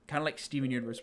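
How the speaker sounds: normal talking voice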